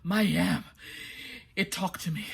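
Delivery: panting words; out of breath. A narrator's reading. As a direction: gasp it out winded. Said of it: "said out of breath".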